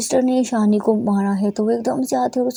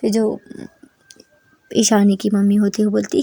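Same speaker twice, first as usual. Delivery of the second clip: ordinary delivery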